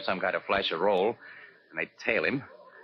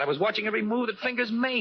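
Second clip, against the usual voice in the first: insisting; 11 semitones higher than usual. Acted. Casey insists to Annie.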